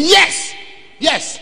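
high-pitched, excited mock assent; imitated keen follower; eager agreement. Man gladly confirming the voice's message.

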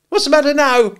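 piercing high, loud, scolding sister; very sharp demand. A narrator's reading. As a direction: rap it out sharply at full voice. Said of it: "more sharply than before".

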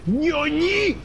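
high, startled squeak; mock anime shock. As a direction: ham up an anime gasp.

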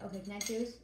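usual speaking voice

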